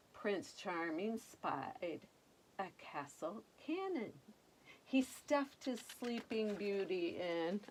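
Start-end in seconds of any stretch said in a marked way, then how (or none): none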